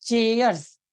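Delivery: चेयर्स said firmly with a long CHAY and clear final s; emphasised ending sound. Shown as a demonstration. Teacher demonstrating plural sound, चेयर्स with emphasised final s. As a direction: stretch चेयर्स and make its ending heard. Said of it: "स का sound आ रहा है".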